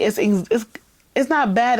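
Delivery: usual speaking voice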